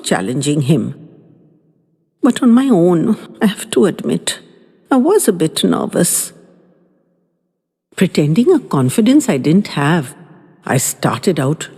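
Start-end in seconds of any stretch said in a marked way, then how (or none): none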